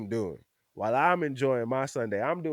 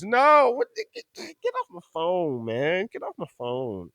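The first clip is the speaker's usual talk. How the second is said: high sharp then fading brush-off; a fan hanging up on a teasing friend; mock annoyed; 6 semitones higher, 4 dB louder than usual. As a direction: shoo him off the phone. His own retort quoted.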